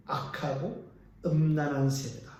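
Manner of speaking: ordinary delivery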